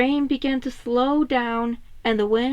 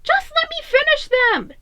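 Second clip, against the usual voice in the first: raised, high pleading argument; high Donnie voice; 5 dB louder than usual. Acted. Donnie pleading to keep doing dishes, high and insistent. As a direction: brush off help in a high voice. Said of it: "Donnie argued".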